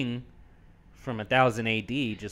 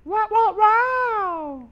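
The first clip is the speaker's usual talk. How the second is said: high squeaky wow wow wow; enemy sound imitation; 7 dB louder, much slower than usual. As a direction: imitate the monster's cry. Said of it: "That's the sound they make".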